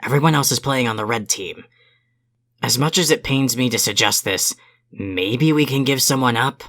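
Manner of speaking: ordinary talk, nothing marked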